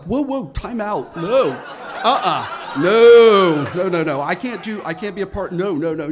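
high, loud laughing protest; laughing refusal. Retold or imitated. Neighbor refusing to be involved, laughing loud protest. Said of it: "and I was like".

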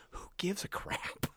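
high, hushed whisper of disbelief; mock whisper. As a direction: whisper it as a dismissive aside. Mocking own reaction to the scene.

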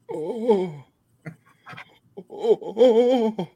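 high, drawn-out mock moan. Joking demonstration of a Greek accent, high mock moans. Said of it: "do a pretty good Greek accent".

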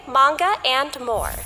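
ordinary delivery

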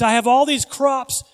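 preacher playing an overwhelmed rich man, loudly; rich farmer's question. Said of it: "So the question he did is".

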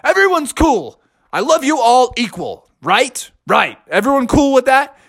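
lightly voiced dad reassuring the family; story dad. A narrator's reading.

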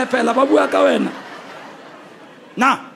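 usual speaking voice

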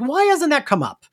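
a retold, mildly bewildered question; baffled. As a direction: ask it in disbelief. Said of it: "And I'm like".

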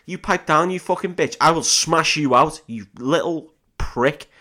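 high, loud mock tirade; hammed-up rage, slowing on the last insult; acted angry swearing. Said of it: "so he starts swearing".

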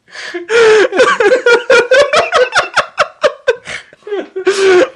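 long, high, loud laughing fit; helpless laughter. Real, not acted. Podcaster losing it over a remembered skit, helpless laughter. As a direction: crack up completely, unable to stop. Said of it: "I'm sorry about that".

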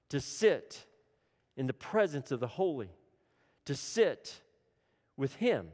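ordinary talk, nothing marked

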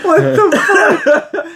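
high, loud burst of disbelief; real astonishment. Real, not acted. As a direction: blurt it out, loud and incredulous. Stunned by absurd video idea.

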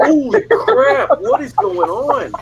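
high, drawn-out astonishment; stunned disbelief. Retold or imitated. Players watching their coaches throw punches, stunned disbelief. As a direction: imitate a stunned onlooker, slow and high-pitched.

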